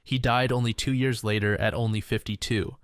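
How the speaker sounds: ordinary delivery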